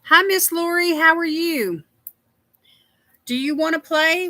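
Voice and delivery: high, loud welcome; cheery greeting. Real, not acted. Host welcoming viewer Lori to the drawing, high and cheery. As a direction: greet her like a friend arriving.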